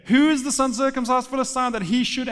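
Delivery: confident challenge. Acted. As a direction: call out the challenge with clarity. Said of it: "with clarity and confidence".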